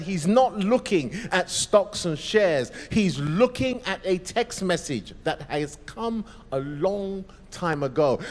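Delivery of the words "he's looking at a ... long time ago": ordinary delivery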